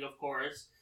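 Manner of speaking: usual speaking voice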